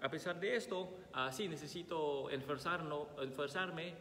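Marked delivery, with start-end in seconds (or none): none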